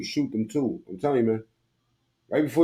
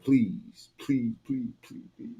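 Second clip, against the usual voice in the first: quiet mock begging; 7 dB quieter, slower than usual. Shown as a demonstration. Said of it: "This is how they sound".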